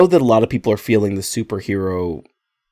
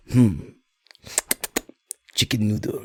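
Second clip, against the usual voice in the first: lightly hammed French accent, soft; quiet French chef sniffing the soup; 6 dB quieter than usual. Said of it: "because he's French".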